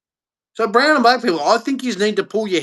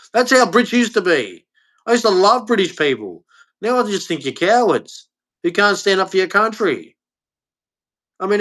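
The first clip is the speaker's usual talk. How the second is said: ordinary talk, nothing marked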